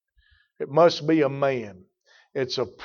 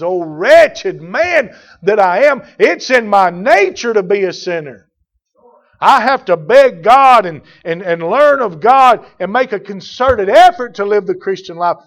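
fiery preaching; 5 semitones higher, 11 dB louder than usual. Acted. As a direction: cry it out like a revival preacher. Preaching at full force.

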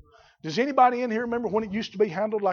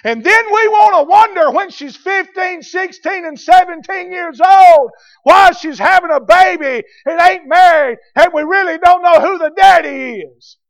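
high, loud, drawn-out pulpit shout; fiery preacher at a sustained high shout; full-force preaching; 11 semitones higher, 16 dB louder than usual. Real, not acted. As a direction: thunder it out to the congregation.

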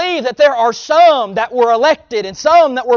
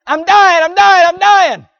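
higher and louder fake-dying whine; mock dramatic wail; 6 semitones higher, 5 dB louder than usual. Preacher mocking babies who act like they're dying, hammed-up wail. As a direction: overplay it like a baby faking death. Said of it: "they'll act like they're dying".

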